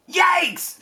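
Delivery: high startled yelp. Acted. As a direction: jump at the egg splat. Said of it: "said Richard".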